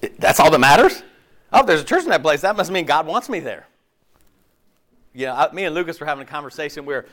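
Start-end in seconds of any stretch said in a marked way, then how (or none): 0.0-3.7 louder, incredulous preaching; mock the idea for the congregation; loud mocking disbelief.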